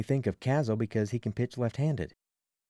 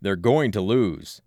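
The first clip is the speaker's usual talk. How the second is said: loud, slow shouted warning; 2 semitones lower, 7 dB louder, slower than usual. Acted. Frustrated stranger shouting at the team, slow and loud. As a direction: bellow it out slowly. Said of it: "the man shouts".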